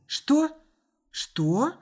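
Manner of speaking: high startled first word, then a puzzled one; bewildered questioning; taken aback. A narrator's reading. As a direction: ask it in confusion. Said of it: "растерялась Анна".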